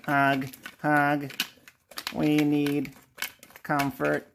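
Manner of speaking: even, droning robot voice. Pretend. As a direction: speak like a hugging robot. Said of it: "Again, the robot voice".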